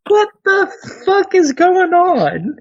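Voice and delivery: high, slow, incredulous; can't believe what he's hearing; stunned disbelief. Real, not acted. Disbelief at celebrity casting news.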